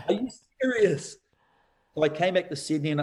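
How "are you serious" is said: high, incredulous cry of disbelief; as if the world is playing a joke on you; pitched-up, almost laughing amazement; stunned disbelief. Disbelief at magazine advert.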